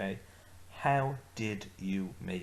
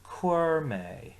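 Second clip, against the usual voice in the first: clear model phrase; 6 semitones higher, 6 dB louder than usual. Shown as a demonstration. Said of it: "So let's say those ones together".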